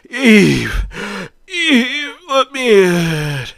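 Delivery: desperate high yell, slowing on let me in; screaming. Acted. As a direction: scream her name at the door, pleading.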